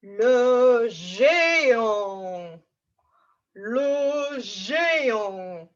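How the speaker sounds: huge, very slow giant; big drawn-out giant word. Pretend. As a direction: stretch it out like a lumbering giant. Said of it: "Can you show me the action as well".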